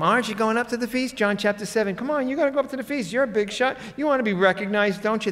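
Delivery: high, fast mocking tease; taunting. Brothers taunting Jesus to go to the feast, high fast mockery. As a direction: needle him like a teasing brother. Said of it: "They're taunting him, they're teasing him".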